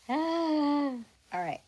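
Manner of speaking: ordinary talk, nothing marked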